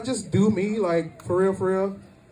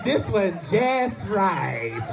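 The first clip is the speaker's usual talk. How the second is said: very slow, drawn-out verdict; Goldilocks parody; much slower than usual. Acted. Comic mocking women as Goldilocks, drawn-out drawl. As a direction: stretch each word out luxuriously. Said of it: "Just three little bears and Goldilocks".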